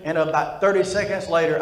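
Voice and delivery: normal talking voice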